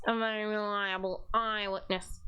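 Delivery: usual speaking voice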